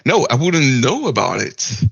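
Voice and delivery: normal talking voice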